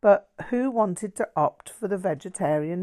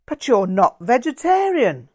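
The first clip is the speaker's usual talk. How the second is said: higher, louder, self-righteous accusation; righteous zeal; 7 semitones higher, 10 dB louder than usual. Acted. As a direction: call her out with smug moral zeal. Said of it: "stated with evident righteous zeal".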